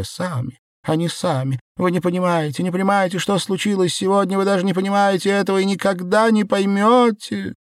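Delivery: high, strained, despairing rant; acted hysterical lament, running on without let-up; tearful hysteria. Белка rants before bursting into tears.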